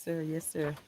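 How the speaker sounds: ordinary delivery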